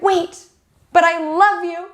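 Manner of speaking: lightly voiced, high pleading movie confession; movie lover's plea. Other character to the one leaving, high mock plea. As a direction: play the lover begging at the last second. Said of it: "the other character is like".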